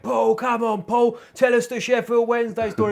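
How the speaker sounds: high, drawn-out calling across a room; sing-out cajoling call; egging him on. Retold or imitated. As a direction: imitate a rowdy fan begging for a story. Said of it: "they were talking like that".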